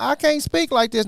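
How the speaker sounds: high, loud, overcome outburst; overwhelmed exclaim. Retold or imitated. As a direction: cry out that the words aren't yours. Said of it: "I'm like".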